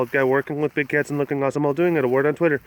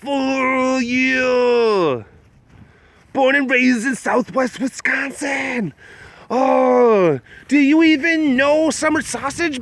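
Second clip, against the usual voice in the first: higher, slow, drawn-out local bro hype; Wisconsin bro; 6 semitones higher, slower than usual. Pretend. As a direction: ham up a proud Wisconsin dude. Hyping up Wisconsin to chat.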